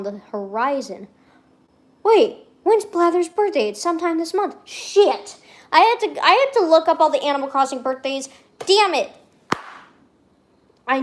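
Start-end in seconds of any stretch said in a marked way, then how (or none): 2.0-9.1 high, loud exasperated outburst; sudden alarm. Real, not acted. Streamer realising they forgot a character's birthday, alarmed. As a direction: blurt it out in rising frustration.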